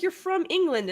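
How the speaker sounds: high-pitched mock-incredulous retort; retold exasperation. Retelling an accent argument to a friend, high-pitched exasperation. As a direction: re-enact insisting in disbelief.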